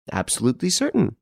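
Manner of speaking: put-on old lady's high, assured reply. A narrator's reading. Old lady answers on telephone.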